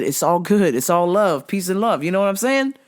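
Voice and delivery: usual speaking voice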